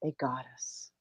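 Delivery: soft, hushed adoring words; whispered worship of wife; tender praise. Pretend. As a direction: murmur it to a goddess. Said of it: "you turn to her and you go".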